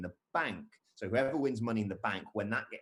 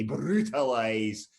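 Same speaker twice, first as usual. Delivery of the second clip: "brutalize" drawn out slowly and louder in a Scottish accent; 6 dB louder, much slower than usual. Shown as a demonstration. Host showing the word he'd say to a Scotsman, slow Scottish. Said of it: "But I will to a Scotsman say".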